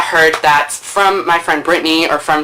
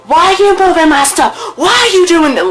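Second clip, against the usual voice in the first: high, loud squeaky mock of a furious boy; mocking the angry classmate; 12 semitones higher, 4 dB louder than usual. Mimicking the boy who came at her, high and loud. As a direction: parrot his outraged questions in a silly high voice.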